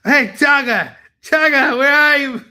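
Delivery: playful hammed-up holler; calling out. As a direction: shout for Chaga as if searching. Calling out for someone.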